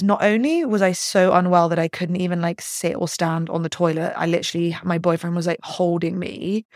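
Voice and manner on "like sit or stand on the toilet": usual speaking voice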